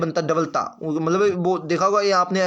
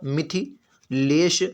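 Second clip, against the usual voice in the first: Mithilesh drawn out as "Mi-thi... lesh", then Kumari; slow syllable-by-syllable; much slower than usual. Shown as a demonstration. Teacher answering "what is it?", Mithilesh spelt out slowly by syllable. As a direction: dictate Mithilesh very slowly in parts. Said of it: "क्या होता है?".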